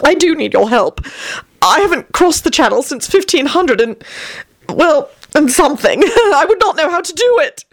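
rapturous. Acted. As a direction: gush it out in raptures. Vampire Tony's voice.

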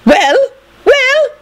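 very high, loud demand; shrieking. A narrator's reading. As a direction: demand an answer from the top of the stairs. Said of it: "McGonagall shrieked from the top".

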